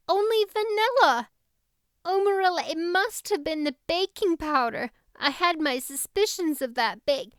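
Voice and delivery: put-on very high, upset young girl; a flustered girl, pitched high and pleading; distressed Anne. Anne pleads over the cake.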